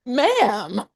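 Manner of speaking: high, flat disbelieving "Ma'am"; retold stunned disbelief. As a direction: speechless at the host. Stunned at racist host.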